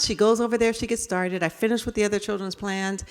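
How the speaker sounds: ordinary talk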